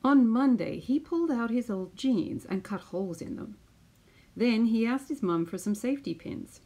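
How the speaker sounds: put-on lively storytelling voice for children; picture book read-aloud. Teacher reading a picture book to her class, lively storytelling. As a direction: read it out like story time on the mat.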